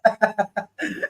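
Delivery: stuffy congested voice; blocked nose. Real, not acted. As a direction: talk through a blocked nose. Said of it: "I'm so congested".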